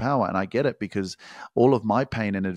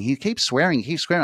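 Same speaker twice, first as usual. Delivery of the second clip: higher, whiny tattling voice; mock complaining listener; 7 semitones higher than usual. Coach mocking viewers who whine about his swearing. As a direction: parrot the prudish listener's complaint.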